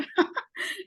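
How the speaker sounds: a short breathy chuckle. Real, not acted. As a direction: laugh it off lightly. Laugh before calling on Adam.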